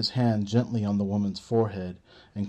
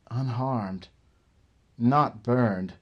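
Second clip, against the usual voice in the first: ordinary delivery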